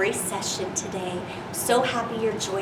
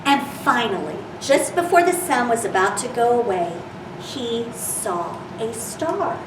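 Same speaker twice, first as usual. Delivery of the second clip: gentle storybook reading voice; reading to children. Acted. Reads picture book to children.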